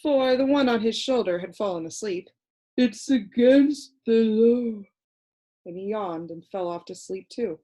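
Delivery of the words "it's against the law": slow, drowsy creature drawl; a dull little creature explaining to Milo, slow and sleepy; sleepy Lithargian. A narrator's reading. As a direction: drone it lazily like a half-asleep creature. Creature speaks.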